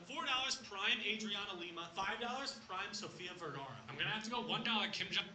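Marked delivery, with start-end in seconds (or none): none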